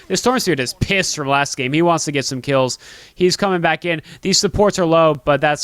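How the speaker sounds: fast, loud hype commentary; excited play-by-play. Acted. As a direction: ramp up the call as the kill lands. Live play-by-play burst.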